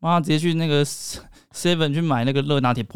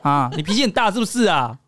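high, laughing mock-whiny girlfriend; a playful girlfriend scolding her boyfriend back, pitch up; sassy girlfriend; 8 semitones higher than usual. Girlfriend teasing boyfriend who can't find her, high mock whine. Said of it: "然后如果是你就说".

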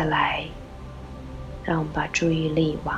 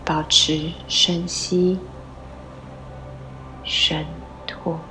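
slow breathing cue; much slower than usual. Shown as a demonstration.

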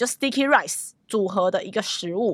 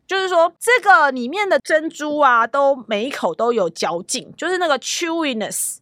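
usual speaking voice

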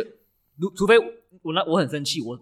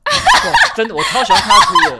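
squealing laugh through the words, much higher and louder; can't get the words out for laughing; laughing hard; 13 dB louder than usual. Real, not acted. Gushing about girlfriend crying, laughing loudly.

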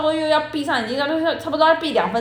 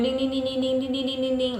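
alarm ringing imitation; 7 dB quieter than usual.

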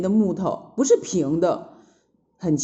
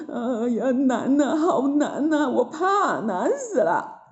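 higher, wavering whine; scared whimpering; 5 semitones higher, slower than usual. A narrator's reading. Disciples too scared to cross, whimpering with finger bitten. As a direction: whimper it in acted fear. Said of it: "吓得".

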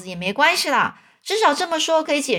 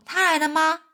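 a higher, eager aunt's question; 6 semitones higher than usual. A narrator's reading. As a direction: ask it like a hopeful old aunt. 阿姨焦急问老先生. (阿姨急切地问)

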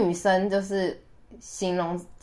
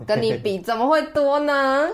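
teasing laugh; 6 semitones higher than usual. Real, not acted.

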